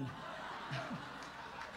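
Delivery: a soft, breathy, unvoiced laugh. Real, not acted. Laughing at his own joke to the congregation, breathy laugh.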